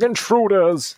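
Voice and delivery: high, hammy alarm shout; rubbish robot voice. Acted. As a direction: squawk it like a bad robot. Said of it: "That was a bit rubbish".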